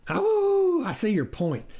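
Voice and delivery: a high "Oh" then easy agreement; stranger admitting. A narrator's reading. The wolf admits the farmer's point, smooth. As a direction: concede smoothly. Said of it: "the furry stranger admitted".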